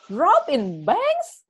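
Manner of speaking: slow, incredulous rising repeat; surprised question. Retold or imitated. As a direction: drag it out in disbelief. Retold stranger repeating name.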